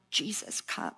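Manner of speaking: hushed cry of Come Jesus; hushed prayer cry. Shown as a demonstration. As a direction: breathe the plea almost under your breath. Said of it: "our cry gets louder".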